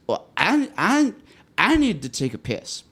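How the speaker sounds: put-on high, stumbling drunk voice; hammered drunk. Drunk date announces a bathroom trip, high and slurred. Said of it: "she was so hammered".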